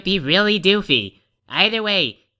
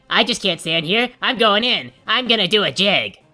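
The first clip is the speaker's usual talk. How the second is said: ordinary talk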